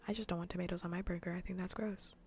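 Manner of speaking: normal talking voice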